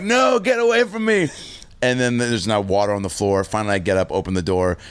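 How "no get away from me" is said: put-on higher, whiny drunk refusal; drunk brush-off. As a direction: shoo him off like a stubborn drunk. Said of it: "I'm like".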